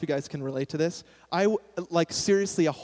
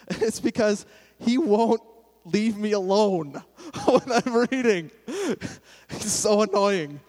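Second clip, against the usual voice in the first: slow, loud, high-pitched comic complaint; playfully exasperated; 5 semitones higher, 5 dB louder, slower than usual. Real, not acted. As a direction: complain loudly and slowly, drawing it out with a laugh. Comic complaint about God, laughs.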